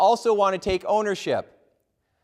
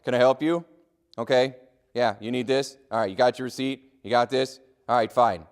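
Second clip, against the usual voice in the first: lower, bored and brushing off the customer; a clerk on the phone who never looks up; dismissive clerk; 5 semitones lower than usual. Retold or imitated. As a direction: rattle through like you couldn't care less. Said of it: "It was more like".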